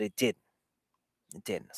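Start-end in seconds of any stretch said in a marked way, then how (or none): 1.4-1.8 quiet repeat. Real, not acted. Repeats the admission, fading.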